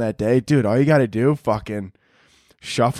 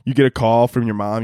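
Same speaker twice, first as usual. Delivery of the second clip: ordinary talk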